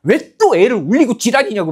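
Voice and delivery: loud, high-pitched telling-off; acted-out irate boss; scolding director. Director chewing out a teacher for the crying child, mock angry scolding. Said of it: "또 이제 원장님 와서".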